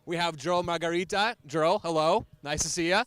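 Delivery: punchy, projected welcome to the crowd; live show host intro. Real, not acted. Host introducing coach and players to a live audience, projected announcer delivery. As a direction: open the show big, stressing key words.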